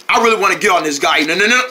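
ordinary talk

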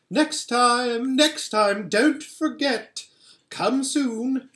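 high loud call, slowing at the end. A narrator's reading. Queen calling to Edmund from the sledge, high and loud. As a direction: call after him as the sledge leaves. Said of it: "calling out".

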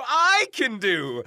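ordinary delivery, nothing marked

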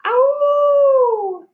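long high howl like a wolf. A narrator's reading. Reading a wolf story aloud, howling like a wolf. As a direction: throw your head back and howl. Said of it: "a wolf is howling".

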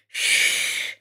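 long unvoiced shush; hushing. A narrator's reading. Little Hoot quieting friends as Grandma sleeps, hushing. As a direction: hush them softly.

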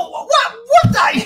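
high laughing mock outrage; laughing disbelief. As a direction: sputter in disbelief while laughing. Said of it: "He goes".

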